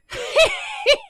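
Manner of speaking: very high, loud mad cackle; maniacal laugh. Acted. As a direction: cackle like a crazed Santa. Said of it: "And he goes".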